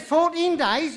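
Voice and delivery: loud, high outraged disbelief; acted indignant outburst, pitched right up; incredulous protest. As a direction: throw the sentence back in shock. Defendant protests the harsher sentence.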